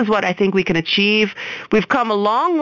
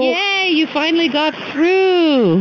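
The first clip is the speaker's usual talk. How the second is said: slow, high, delighted cheer; excited; 8 semitones higher, slower than usual. Real, not acted. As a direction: welcome them in with a happy yay. Host cheering caller's arrival.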